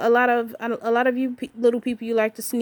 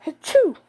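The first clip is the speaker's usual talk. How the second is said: fake sneeze; 8 semitones higher, 5 dB louder than usual. Shown as a demonstration. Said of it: "Or if you have to sneeze".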